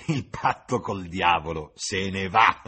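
loud, slow, raspy sneer; hoarse jeering cackle. A narrator's reading. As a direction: jeer it out hoarsely, gloating. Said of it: "sghignazzò con voce rauca".

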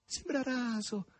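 a maid's high, ecstatic gushing; gushing cries. A narrator's reading. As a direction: exclaim over her beauty while hugging her. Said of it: "baciandola e gridando".

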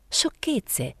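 mild mock shout, pitch jumps up; cry out. A narrator's reading. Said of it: "gridò Mariella".

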